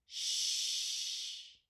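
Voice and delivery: "Shh" as one long, steady rush of air; long sh sound. Shown as a demonstration. Teacher showing the mouth position, long "Shh". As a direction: draw out "Shh" without voice. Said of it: "I hear the air come long".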